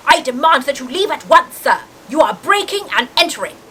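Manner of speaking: hammed-up outraged householder; indignant demand. A narrator's reading. Uncle Vernon ordering the giant out, indignantly. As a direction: order them out with pompous indignation.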